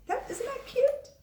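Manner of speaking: high, slow, cooing; gushing delight. Real, not acted. Teacher coos to her class about a child's answer, high and slow. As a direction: coo over how sweet it is.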